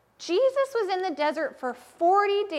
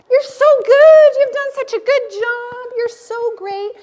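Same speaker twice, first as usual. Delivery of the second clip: much higher and louder, slow cheering; cheering yourself; 9 semitones higher, 14 dB louder than usual. Shown as a demonstration. Teacher leads children cheering themselves on. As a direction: shout praise at yourself with delight. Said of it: "Tell yourself".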